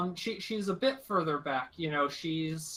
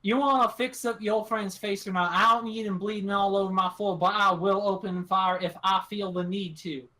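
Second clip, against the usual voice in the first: louder, forceful rural captor; threatening country woman; 4 semitones higher, 5 dB louder than usual. Acted. Armed woman threatening her captives over bleeding, loud and firm. GM voices gun-toting woman.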